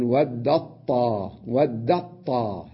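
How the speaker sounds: ود الطاء slow, the t merged into a doubled ط; ود الطاء drawn out, wadd-ṭā; assimilation demo. Shown as a demonstration. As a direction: say ود الطاء with the ta swallowed into ط. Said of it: "أنا أدغم التاء في الطاء وأقول".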